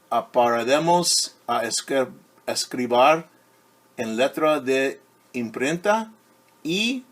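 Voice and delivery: lively picture-book read-aloud; reading to children. A narrator's reading. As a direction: read it brightly to a class of kids.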